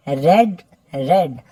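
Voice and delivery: रेड said like the colour red, short e; रेड rhymes with bed, past form of read; past tense read said red. Shown as a demonstration. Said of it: "वो मैंने pronounce किया है रेड".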